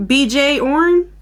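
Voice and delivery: "B J Orn" spelled out slowly, letter by letter, then "orn"; spelling it out. Shown as a demonstration. Unsure how to say the Norse name, slow spelled-out guess. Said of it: "how people who speak English pronounce that name".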